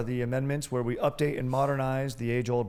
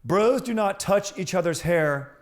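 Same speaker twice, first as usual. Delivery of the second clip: high, slow, louder announcing voice; formal proclamation; 8 semitones higher, 6 dB louder, slower than usual. Pretend. Host rereading the amended article to listeners, proclaiming. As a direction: declaim it cleanly like a decree.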